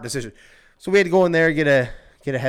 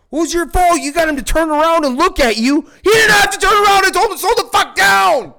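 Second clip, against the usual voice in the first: high, loud retold argument, building to a yell; heated shouting match; 8 dB louder than usual. Friends argue over blame for the crash, indignant shouting. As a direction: yell it out in indignant protest, pitch way up.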